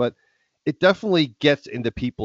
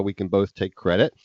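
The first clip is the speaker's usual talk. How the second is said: ordinary talk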